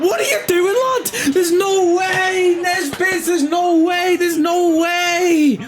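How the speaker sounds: very high, drawn-out scream of disbelief; screaming like a monkey. Real, not acted. Gamer whose striker misses a free header, screaming. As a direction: scream helplessly at the missed chance. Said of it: "you've got me screaming like a fucking monkey".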